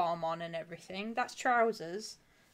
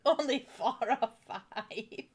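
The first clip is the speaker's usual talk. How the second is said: slow, drawn-out disbelief; incredulous echo; slower than usual. Real, not acted. Reacting to friend's drink count, slow incredulous echo. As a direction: repeat it back slowly, incredulous.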